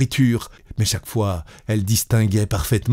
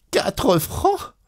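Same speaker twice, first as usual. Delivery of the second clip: much higher, squeaking with indignant disbelief; outraged disbelief; 11 semitones higher than usual. Acted. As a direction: repeat it even higher, as if she can't believe it. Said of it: "Elle eut un sursaut".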